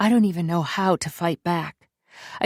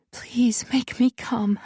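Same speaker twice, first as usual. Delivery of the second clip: slow breathy whisper; whispered plea; 6 semitones higher, slower than usual. A narrator's reading. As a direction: whisper it, slowly begging.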